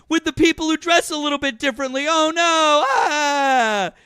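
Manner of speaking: high, loud mock panic; mock fright. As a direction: gasp in fake fear. Mocking fear of drag queens.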